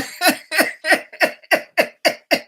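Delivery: high-pitched burst of laughter. Real, not acted. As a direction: laugh it out, tickled by the scolding. Laughing at viewer's scolding.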